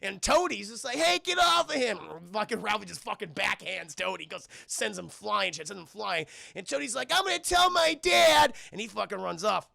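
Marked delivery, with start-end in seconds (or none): 1.0-2.0 put-on high, loud kid's shout; acted urgent holler; protesting yell. Friend shouts to stop fight. 7.1-8.5 high, loud whiny threat; hammed-up tattletale holler; tattling yell.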